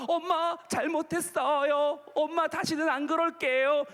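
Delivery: hammed-up tearful apology; mock tearful pleading. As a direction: plead like a guilty kid faking tears. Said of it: "막 눈물 흘리는 거야".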